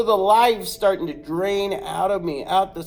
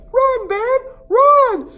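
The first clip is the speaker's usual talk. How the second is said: much higher, louder yell to run; frantic shout; 11 dB louder, slower than usual. Retold or imitated. Cousin screams at the boy to run, much higher and louder. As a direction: scream the warning. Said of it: "He's like".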